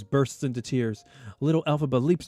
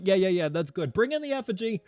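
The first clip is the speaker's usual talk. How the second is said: breezy, much higher mock crowd voice; mock eager crowd; 7 semitones higher than usual. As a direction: wave it along like a dismissive crowd. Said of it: "they're like".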